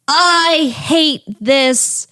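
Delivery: mock angry kid rant. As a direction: act out a furious kid's rant, bleeping the swears. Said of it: "Charlie just says".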